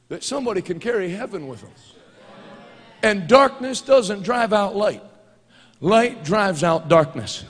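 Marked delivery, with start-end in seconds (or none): 3.0-7.4 loud, slow preached proclamation; slow, booming sermon cadence; preaching at full force. Real, not acted. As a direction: thunder it out slowly from the pulpit.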